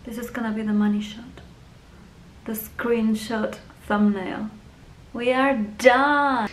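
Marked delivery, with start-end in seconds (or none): 5.1-6.5 slow, loud, drawn-out declaration; triumphant announcement. Real, not acted. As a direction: announce the finish proudly. Announces finished look.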